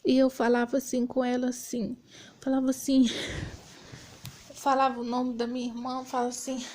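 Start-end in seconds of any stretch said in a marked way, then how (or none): none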